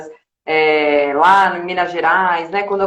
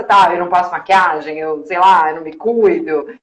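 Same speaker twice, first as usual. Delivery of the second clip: ordinary delivery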